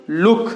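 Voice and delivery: "लुक" with a quick, brief OO; short OO. Shown as a demonstration. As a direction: keep the vowel in "लुक" short. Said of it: "जल्दी से ऊ". ("लुक" में छोटा ऊ)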